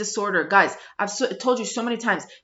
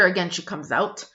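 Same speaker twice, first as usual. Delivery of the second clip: ordinary delivery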